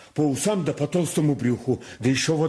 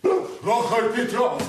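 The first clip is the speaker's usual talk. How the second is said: calling out; 8 semitones higher, much slower than usual. Acted. Servant calling master from afar.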